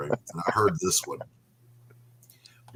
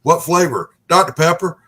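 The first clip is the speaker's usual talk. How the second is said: drive-thru clerk; 8 semitones higher, 10 dB louder than usual. Retold or imitated. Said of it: "they would say".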